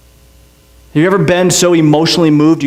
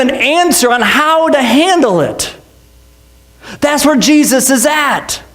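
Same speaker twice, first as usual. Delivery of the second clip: raised, slowed pulpit cry on Jesus; preaching at full force; 9 semitones higher, slower than usual. Acted. Pastor to congregation, preaching hard and raised. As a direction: proclaim it high and slow.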